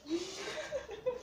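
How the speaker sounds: a quick, high, soft giggle. Real, not acted. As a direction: giggle at yourself, lightly. Laughs at her own forgetfulness. (petit rire aigu)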